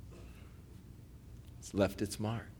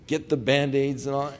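ordinary delivery

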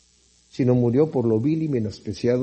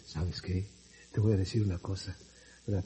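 very low, hushed confiding voice; fake sweet-talker voice; 9 semitones lower, 16 dB quieter than usual. Preacher imitates a flatterer confiding in you, voice suddenly changed, hushed and low. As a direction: drop into a soft, secretive murmur to feign intimacy. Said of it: "de repente cambia la voz y te dice".

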